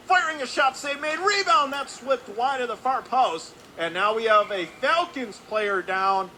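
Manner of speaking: high, loud shot call easing slightly; excited play-by-play. Real, not acted. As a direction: burst out with the shot and rebound. Live call of shot and rebound.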